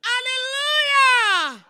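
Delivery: huge, high, drawn-out worship shout; long full-force pulpit cry; shouted praise. Real, not acted. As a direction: belt it out to lift the whole congregation. Leads congregation in shouted praise.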